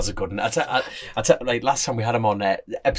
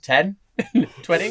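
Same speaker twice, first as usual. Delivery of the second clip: laughing mock bidding; 6 semitones higher, slower than usual. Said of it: "just went".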